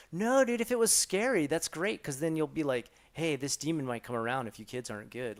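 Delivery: imitated excitement, high and loud at first; acted-out eager reassurance; enthusiastic coworker. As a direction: start high and loud, then settle as you explain. Acting out coworkers' eager reply.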